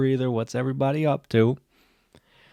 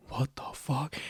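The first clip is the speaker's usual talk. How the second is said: breathy whispered what the fuck; whispered disbelief; 3 semitones higher, 11 dB quieter than usual. Retold or imitated. Stu waking up in space, whispering in shock. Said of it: "He's like".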